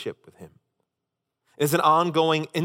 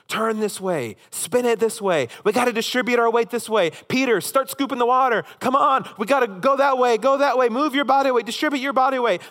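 higher, urgent shouted orders over the storm; frantic yelling crew; 6 semitones higher than usual. Acted. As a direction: bark orders at the crew as the boat sinks. Disciples yelling at each other.

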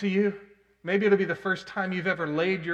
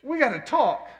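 urgent plea; 7 semitones higher, 7 dB louder than usual. Retold or imitated. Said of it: "I said".

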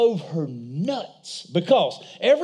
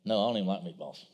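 son's voice; 14 semitones lower, 10 dB quieter, much faster than usual. Retold or imitated. Son voiced, low and soft.

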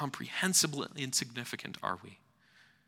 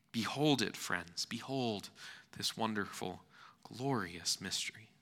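ordinary talk

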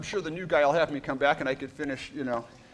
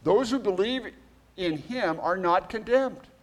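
scripture reading; 4 semitones higher, 3 dB louder than usual. Acted. Reads scripture aloud.

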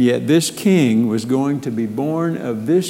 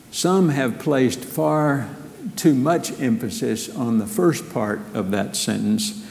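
ordinary talk, nothing marked

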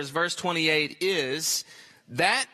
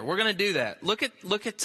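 normal talking voice

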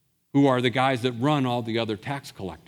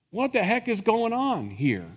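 ordinary delivery

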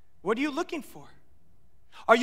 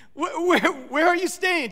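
much higher and louder, excited; eager blurt; 7 semitones higher, 5 dB louder than usual. Acted. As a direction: blurt it out like an overeager kid. Disciples' eager odd reply repeated.

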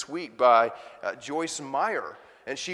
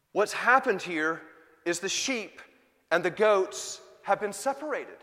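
ordinary talk, nothing marked

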